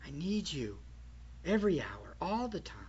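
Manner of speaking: very soft, low plea to God; hushed prayer. Acted. Preacher speaks to God of needing him, hushed prayer. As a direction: drop right down into intimate prayer.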